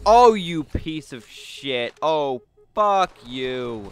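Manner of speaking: slow, aggrieved curse, starting loud; drawn-out cursing. Real, not acted. Player cursing the game, drawn-out anger. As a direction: stretch each word out in disgust.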